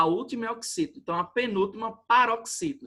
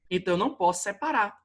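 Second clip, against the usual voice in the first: usual speaking voice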